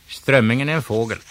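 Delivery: flustered quick answer; blurted answer. Acted. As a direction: rush it out in panic. Nervous wrong answer blurted.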